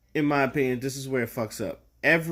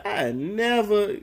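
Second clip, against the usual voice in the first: slow, higher "I never", playing innocent; mock innocent denial; 7 semitones higher, much slower than usual. Pretending to deny it to his friends, higher and slow. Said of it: "I'ma say".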